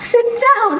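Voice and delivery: high, loud wailing sob of a house elf. A narrator's reading. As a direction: cry the words out high. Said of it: "he wailed".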